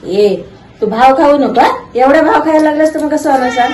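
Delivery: high, loud playful scolding. Real, not acted. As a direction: tease the cat, sing-song.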